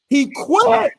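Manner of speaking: high, loud declaration; hyped shout that he quit; excited shout. Real, not acted. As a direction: yell it like a final verdict.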